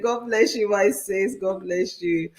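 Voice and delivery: ordinary talk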